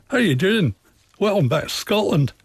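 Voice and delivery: high, lively mock Scottish greeting; Scottish accent. Author imitates Scots across the river, lively Scottish accent. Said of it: "talk with a very different accent".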